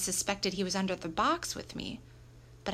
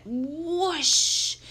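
high, loud drawn-out whoosh; imitated water whoosh; 7 semitones higher, 7 dB louder than usual. A narrator's reading. Water shooting out of the hose, loud whoosh.

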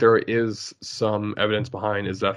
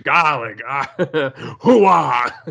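yelling Wario; 3 semitones higher, 6 dB louder, much slower than usual. Retold or imitated. Picturing Al Pacino as Wario, yelling all the time. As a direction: yell it like a hammed-up Wario. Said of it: "him yelling all the time".

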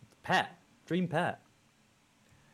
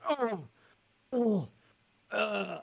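high, rising dithering cries; confused spectators; 14 semitones higher, much slower than usual. Retold or imitated. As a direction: whine it out in panic. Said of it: "while people go".

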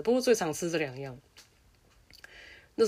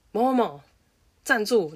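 loud, sharp call to halt a pupil; stern school dean yelling across the gate; teacher's shout; 5 semitones higher, 6 dB louder than usual. Retold or imitated. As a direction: bark the name like a strict teacher.

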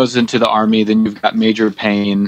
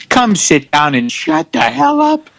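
high, slow grumpy husband; Archie Bunker; 11 semitones higher, slower than usual. Acted. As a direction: grumble at the wife, slowly. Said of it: "A man yells at his wife".

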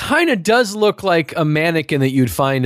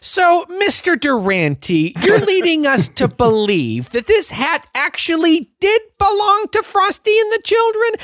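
cross-examining lawyer; 12 semitones higher than usual. Pretend. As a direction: grill the witness, rising in disbelief. Mock courtroom cross-examination.

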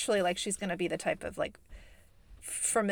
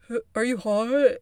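lightly voiced guy on an intercom, raised pitch; imitated male voice; 4 semitones higher, 6 dB louder than usual. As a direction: imitate a man calling out a question. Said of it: "It's a, a male voice".

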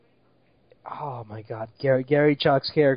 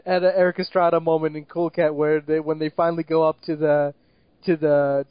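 usual speaking voice

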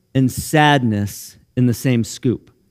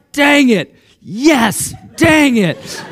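high, loud mixed groan and cheer, laughing; torn cheer; 10 semitones higher, 4 dB louder, much slower than usual. Retold or imitated. Father cheering his son's tackle against his team, laughing. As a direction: cheer and curse it at once.

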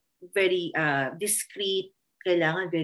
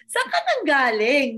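mock astonishment; 6 semitones higher, 5 dB louder than usual. Reacting to godchild's twangy English.

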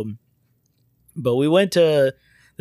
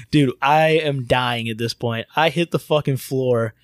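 ordinary talk

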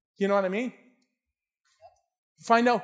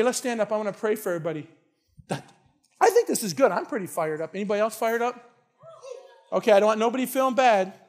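ordinary talk

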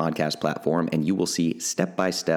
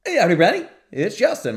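much higher, louder hammed-up greeting; mock cut-off intro; 13 semitones higher, 7 dB louder, faster than usual. Host mimicking the clipped recording, hammed-up high greeting. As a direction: squeak out the clipped intro as a joke. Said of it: "and it's like".

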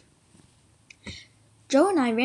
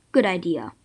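usual speaking voice

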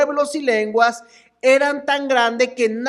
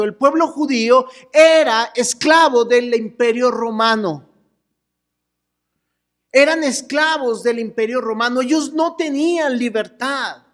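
ordinary delivery

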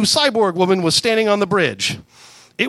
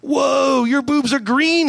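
higher, slow amazed whoa; exclaiming; 8 semitones higher, much slower than usual. Acted. Star-Lord staring at Gamora, slow higher exclaim. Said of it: "he exclaimed".

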